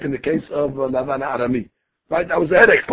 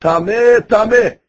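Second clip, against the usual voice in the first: raised, louder imitation of a public cry; mild mock scream; 5 semitones higher, 5 dB louder, slower than usual. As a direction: call it out, half-shouting. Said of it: "he has to scream on top of his lungs".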